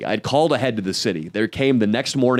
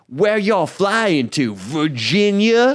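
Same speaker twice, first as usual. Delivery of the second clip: much higher, slow nosy lady voice; imitating the neighbor; 7 semitones higher, much slower than usual. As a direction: drawl the nosy neighbor's question. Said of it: "She's like".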